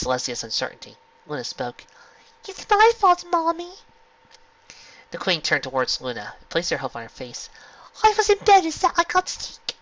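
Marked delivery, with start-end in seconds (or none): 2.5-3.7 put-on very high child voice; little girl voice. A narrator's reading. Little sister confesses. 8.0-9.8 put-on very high child voice; little girl voice. A narrator's reading. Little sister explains.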